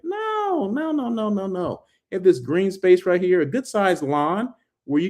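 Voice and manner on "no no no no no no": a run of nos, starting far higher, then falling; emphatic no. Acted. Hotel vlogger rejecting viewers' assumption about no green space, emphatic sing-song no. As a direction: playfully shoot the idea down. Rebutting a common misconception.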